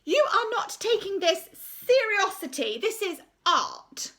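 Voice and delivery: high, slow, affronted teacher's voice; haughty art teacher. Acted. Art teacher scolding the laughing hall, high and slow. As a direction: looks down her nose at the whole hall.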